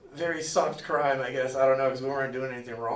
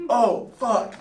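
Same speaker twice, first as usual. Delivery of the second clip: dismayed realization; 8 semitones higher, 9 dB louder than usual. Retold or imitated. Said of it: "I was like".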